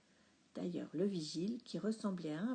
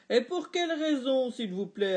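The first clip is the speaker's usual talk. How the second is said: loud, higher repeated demand; insistent dad; 4 semitones higher, 10 dB louder than usual. A narrator's reading. Dad insisting to waitress, louder than before. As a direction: push for a reason.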